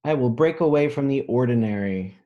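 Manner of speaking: bold, proud storybook read; reading a picture book aloud. A narrator's reading. Teacher reading a picture book to children, bold and proud. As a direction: read it out like a brave promise.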